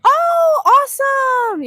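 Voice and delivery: high, loud burst of delight; delighted exclamation. Real, not acted. Delighted reply to a viewer.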